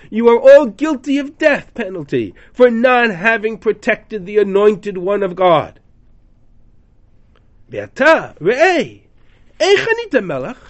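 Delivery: loud, high, indignant rebuke; rebuking shout. Retold or imitated. David scolding Avner for not guarding the king, loud and indignant. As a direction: scold him from across the valley.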